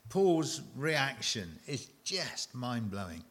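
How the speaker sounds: normal talking voice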